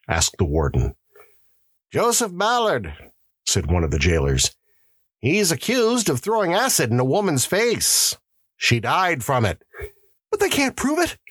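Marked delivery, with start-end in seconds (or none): 1.9-9.6 much higher jailer's voice. Acted. Jailer answers the warden. 10.3-11.2 much higher, panicked gasp; gasped in fear. Acted. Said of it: "gasped the prisoner".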